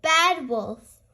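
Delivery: ordinary delivery, nothing marked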